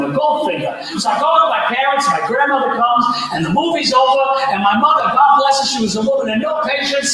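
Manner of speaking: usual speaking voice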